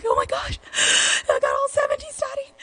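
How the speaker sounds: high, tearful mock-crying plea to dad; imitating sobbing teen. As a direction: retell her younger self wailing over a report card, hammed up. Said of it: "I was crying. I'm like".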